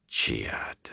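breathy unvoiced whisper of one name; muttered. A narrator's reading. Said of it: "Gaul muttered".

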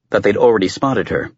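usual speaking voice

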